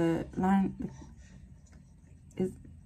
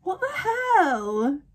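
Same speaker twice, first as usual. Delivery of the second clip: slow, high, tearful cry of disbelief; What the hell, slowed and broken by tears; crying out in shock; 7 semitones higher, much slower than usual. Real, not acted. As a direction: wail it out as the death sinks in. Reacting to a character's death.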